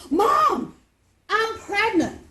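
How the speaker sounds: high, loud blurted confession; teen daughter staging a shock; dramatic announcement. Retold or imitated. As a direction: blurt the big news.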